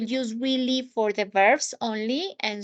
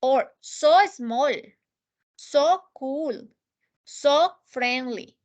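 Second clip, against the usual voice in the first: "so" drawn out and leaned on; stress on so; slower than usual. Shown as a demonstration. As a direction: stretch each "so" slowly. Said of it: "I'm making that stronger".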